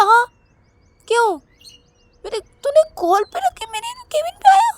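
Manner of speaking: very high, loud yelling; furious yelling. Acted. Sister yelling back at brother, very high loud fury. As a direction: shout him down.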